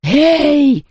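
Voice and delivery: high, sharp menacing call; threatening. A narrator's reading. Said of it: "угрожающе прошипела она".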